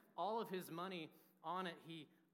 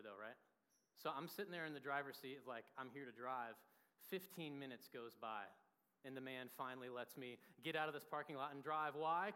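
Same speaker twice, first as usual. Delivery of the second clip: ordinary delivery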